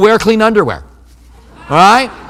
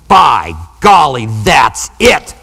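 low, slow fed-up dad blowing up; 5 semitones lower, much slower than usual. Retold or imitated. Dad at his paper snapping at the kids, low mock outburst. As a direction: lower and slower, a dad whose patience just ran out. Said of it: "he'd go".

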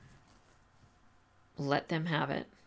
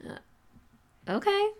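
reluctant student; 3 dB louder than usual. Retold or imitated. Student voiced giving in.

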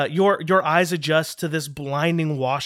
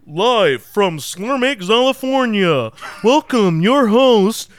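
game show announcer; 6 semitones higher, 8 dB louder than usual. Acted. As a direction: announce the host like a big TV show. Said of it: "that ethereal voice returns".

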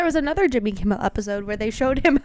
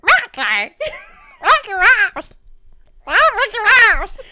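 laughing, much higher and louder mock chant; 10 semitones higher, 9 dB louder than usual. Joking about [name]'s Disney friends, in a laughing mock shout. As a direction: belt it out like a joking rap hype man. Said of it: "He's like".

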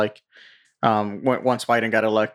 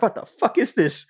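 high, appalled mock question; mock shock; 10 semitones higher than usual. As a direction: recoil in fake horror. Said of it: "You're like".

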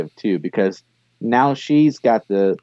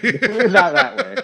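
much higher, slow laughing repeat; giggling slow repeat; laughing hard; 13 semitones higher, slower than usual. Real, not acted. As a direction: dissolve into laughter as you say it.

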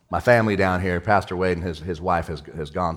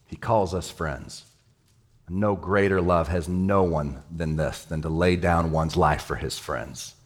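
tender hush; 4 semitones lower, slower than usual. Acted. Pastor reminding listeners they are friends, tender hush. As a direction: let it fall gently on them.

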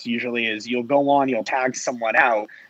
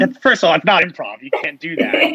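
higher-pitched mock telling-off; mock objection, raised in pitch; imitated calling out; 5 semitones higher, 4 dB louder than usual. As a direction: call someone out in playful imitation. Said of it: "Kat calling me out".

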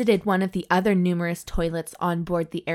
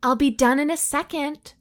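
higher-pitched call through the door; 7 semitones higher than usual. A narrator's reading. Doctor answers the second knock from the toilet, calling out.

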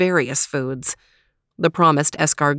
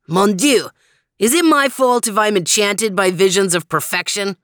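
higher, affronted self-admirer; vain Frenchified character, protesting; 3 semitones higher, 4 dB louder than usual. Acted. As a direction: protest grandly in mock innocence. Voices Max, the French-speaking character.

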